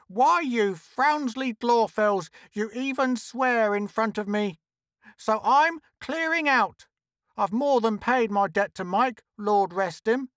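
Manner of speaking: high, scolding voice; high-pitched indignant tirade; aggrieved woman character. Acted. Trixie scolds the miners.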